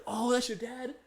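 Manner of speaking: high-pitched, mock surprise; surprised coworkers. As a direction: imitate coworkers reacting with surprise. Coworkers react to learning it's his dad.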